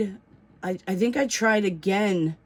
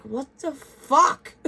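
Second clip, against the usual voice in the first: high, drawn-out exasperated outburst; frustrated; 7 semitones higher, 3 dB louder, slower than usual. Retold or imitated. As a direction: stretch it out in mock frustration, about to laugh. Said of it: "I was so frustrated".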